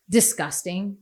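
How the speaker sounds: ordinary delivery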